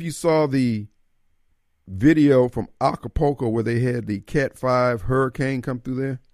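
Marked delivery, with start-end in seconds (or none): none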